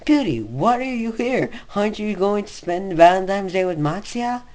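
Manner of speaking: high, loud, eager Cry voice; Cry's voice. Acted. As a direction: pitch up into a giddy Cry. Voicing Cry in the fic.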